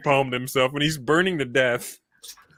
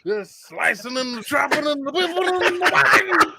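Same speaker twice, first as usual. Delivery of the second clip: Bill Cosby impression; 4 dB louder than usual. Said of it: "I did the Cosby voice all night".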